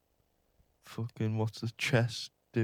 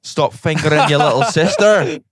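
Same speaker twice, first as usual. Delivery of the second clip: shouted, high Scottish outburst; Scottish accent; 6 semitones higher, 12 dB louder than usual. Acted. Answering the call for Scottish, loud comic outburst. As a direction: bellow it in broad Scottish.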